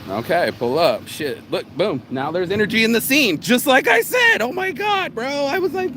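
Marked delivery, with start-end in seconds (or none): 2.1-6.0 stoked the scene finally has energy; excited. Real, not acted. Vlogger thrilled that the scene came alive, excited.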